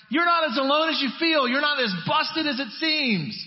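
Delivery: high, loud, impassioned preaching; preaching at full force. Acted. Pastor rallying a hurting friend, loud and high. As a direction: proclaim it like a rousing call.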